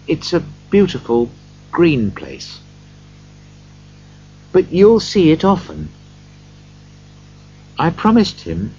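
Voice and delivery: lightly voiced tender father; a hint of grieving father; Bob. A narrator's reading. Bob speaks to his wife.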